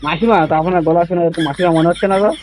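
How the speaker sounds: usual speaking voice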